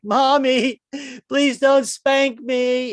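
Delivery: put-on man pleading with his wife like a small boy, high and slow; five-year-old boy. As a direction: beg like a scared small child. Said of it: "turns me into a five-year-old boy".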